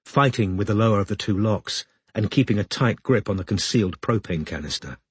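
usual speaking voice

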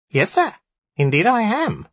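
high, squeaky little imp; squeaky nasal voice. A narrator's reading. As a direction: squeak it out through the nose. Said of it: "squeaky nasal voice".

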